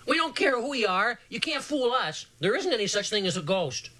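hint of a squawky shout; squawky yell. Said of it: "Portry yelled a squawky yell".

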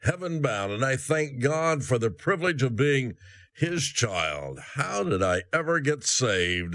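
ordinary talk, nothing marked

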